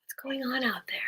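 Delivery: whispered aside. Real, not acted. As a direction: whisper to yourself.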